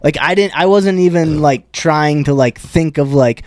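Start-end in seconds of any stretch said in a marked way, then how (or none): none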